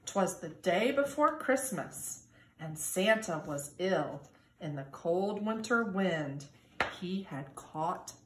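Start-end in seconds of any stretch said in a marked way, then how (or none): none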